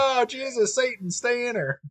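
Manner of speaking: high, pleading cry of a frantic believer; mock desperate prayer. Onlooker begging Satan to stay in her, mock pleading. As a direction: beg Jesus in a squeaky panic. Mocking a praying bystander.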